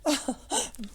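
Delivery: short, high merry laugh. A narrator's reading. Lady arrives wet and cold, merry laugh. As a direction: laugh lightly in mock dismay. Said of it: "with a merry laugh".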